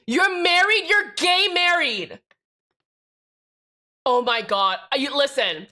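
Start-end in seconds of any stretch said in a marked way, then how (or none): 0.1-2.2 high, loud, incredulous outburst; shocked exclamation. Real, not acted. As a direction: blurt it out high, drag the ending. Exclaiming in shock at chat message.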